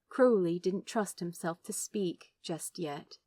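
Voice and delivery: usual speaking voice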